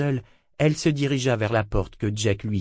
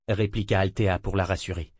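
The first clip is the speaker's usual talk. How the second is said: ordinary delivery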